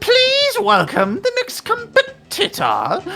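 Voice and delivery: high, loud, drawn-out royal introduction; queen announcing. Acted. Queen welcoming the next competitor, announcing slowly and loudly. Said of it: "says the Queen".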